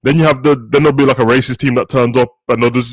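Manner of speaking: usual speaking voice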